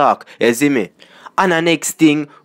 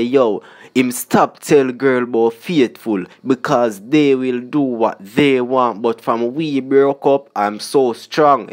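slow lyric recital; slower than usual. Retold or imitated. Reciting the song lyric slowly.

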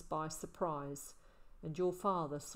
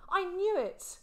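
much higher and louder in delight; happy; 12 semitones higher, 7 dB louder than usual. A narrator's reading. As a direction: burst out with glee. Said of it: "Will said happily".

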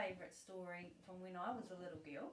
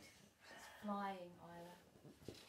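ordinary delivery, nothing marked

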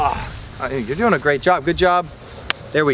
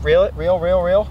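ordinary delivery

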